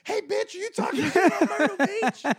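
high, loud, shrill shouting local; angry heckler. Pretend. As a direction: yell it like an offended beach fan. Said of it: "Do you walk up to her little station like".

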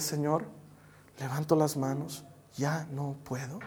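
soft, low prayer of defeat; weary surrender. Acted. Believer telling God they cannot go on, weary surrender. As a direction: give up quietly, haltingly.

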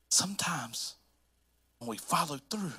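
whispered aside; soft whispered lead-in. Real, not acted. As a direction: breathe the word sometimes. Dramatic hush.

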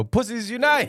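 high, loud battle cry; hammed-up rallying cry. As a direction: shout it like a rallying chant.

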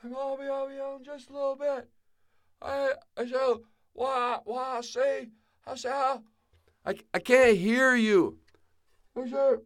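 put-on high old voice calling from inside; muffled old man. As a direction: answer from behind a closed door. Sebastian muffled from outhouse.

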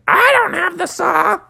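a mom frog calmly denying; mother frog. Acted. Mother Frog telling Father she lacks the saw, high.

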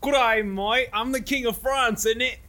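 broad outback Australian; Australian accent. Pretend. Comcast tech voicing Illusionist actors, Australian.

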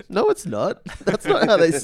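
high, amused mock protest; imitated objection breaking into laughs; laughing disbelief. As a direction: contradict through laughter. Imitating friend contradicting, while laughing.

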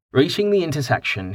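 ordinary delivery

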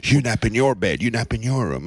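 insistent parent. Retold or imitated. Father mimicking parents worked up over naps, insistent parent. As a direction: lay down the law as a fussy parent.

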